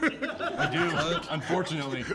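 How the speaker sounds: laughing. Real, not acted. Admits knowing murderers, laughing.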